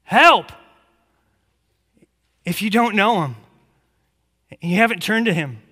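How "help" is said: loud, raised cry for help; demonstrated yell. Shown as a demonstration. Preacher showing what you yell to Christ, demonstrated yell. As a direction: yell it once, sharply higher. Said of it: "That's what you yell to Christ".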